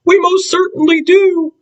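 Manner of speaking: tiny, very high piping voice; piped. Acted. Mayor of Whoville answering Horton, high piping. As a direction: pipe it up brightly from far below. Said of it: "piped the voice".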